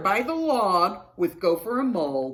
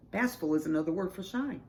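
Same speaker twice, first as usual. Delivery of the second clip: ordinary delivery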